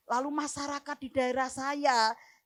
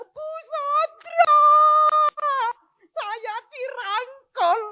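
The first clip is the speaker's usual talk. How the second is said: very high, drawn-out loud wail; retold wail; 14 dB louder, much slower than usual. Bereaved mother crying out and embracing the pastor, loud wail. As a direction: wail the name out slowly and loudly. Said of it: "Dia bilang".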